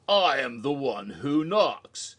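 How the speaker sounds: slow, loud, portentous declaration; lightly voiced menacing villain line. Breaking Bad character entering the stage, slow mock-ominous announcement. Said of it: "the character from Breaking Bad is".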